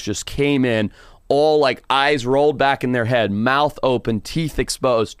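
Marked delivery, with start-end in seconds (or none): none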